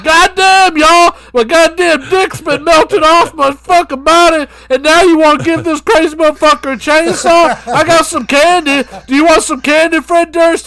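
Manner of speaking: high, very loud wailing protest; hammed-up hollered complaint. Acting [name] complaining.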